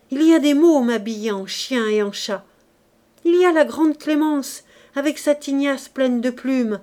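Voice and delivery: feverish, animated exclaiming; excited calling out. A narrator's reading. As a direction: marvel noisily at a passing parade. Delirious man calls out a hallucinated parade.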